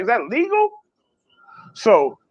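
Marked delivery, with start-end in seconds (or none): 0.0-0.7 high, disbelieving question; a mock shocked squeak; incredulous quote. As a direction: ask it like you can't believe your eyes. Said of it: "I was like".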